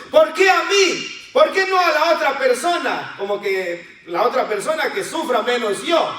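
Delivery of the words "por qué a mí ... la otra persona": bitter complaint. Shown as a demonstration. Believer reproaching God over suffering, high aggrieved wail. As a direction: complain to God like a wronged child, much higher. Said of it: "Es un reclamo que hacemos a Dios".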